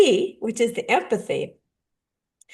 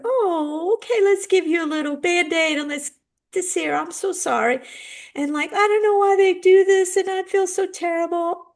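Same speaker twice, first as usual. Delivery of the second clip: higher, fussing mock parent sympathy; gushing sympathy; 8 semitones higher than usual. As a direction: coo over the scraped knee. Parent comforting a hurt kid.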